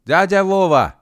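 high, loud cry; shout. A narrator's reading. Gedevan to Uncle Vova at the gates, high shout. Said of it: "крикнул Гидеван".

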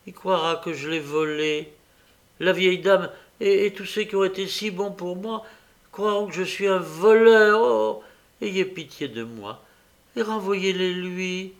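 anguished pleading. A narrator's reading. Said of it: "l'énergie que donne une poignante douleur".

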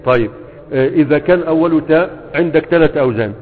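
ordinary delivery

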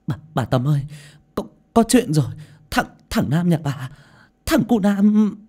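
high, urgent stammering news-bringer; frantic, high-pitched stuttering; breathless stammer. A narrator's reading. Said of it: "thở hổn hển, giọng lắp bắp".

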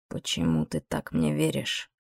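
very low, slow, quiet demon voice; probing low demon question; deep demon voice. Acted.

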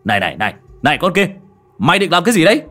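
put-on loud, rising call at the ghost girl; shouted challenge. Cậu Tẩy yelling at the ghost girl, shouted challenge. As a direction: shout her down, building up. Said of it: "cậu Tẩy hô lên".

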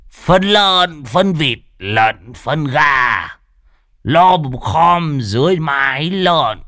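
high, slow hammed-up poem reading; mock poem recital. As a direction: recite the silly poem grandly, slowly. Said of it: "đọc một bài thơ". (ngâm thơ giễu cợt, cao giọng, chậm)

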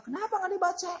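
put-on high, small child's voice asking why; questioning child. Child asking teacher why it isn't read, high child voice. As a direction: pipe up softly like a puzzled little pupil. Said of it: "anak yang nanya-nanya".

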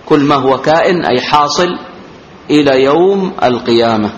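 ordinary talk

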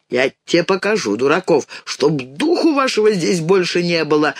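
ordinary delivery

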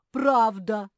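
high, very loud retort; angry insistence. A narrator's reading. Bear cub squaring up to squirrel, angry shout. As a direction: shout it back angrily. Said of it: "сжал кулаки Фил".

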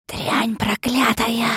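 slow venomous hiss; angry hiss. A narrator's reading. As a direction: spit the curse slowly and hatefully. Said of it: "зло прошипел он". (злобное шипение)

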